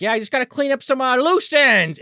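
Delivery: high, loud, stretched-out ending; mock maniac resolve. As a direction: announce it big, dragging the last words. Acts out killer excusing himself.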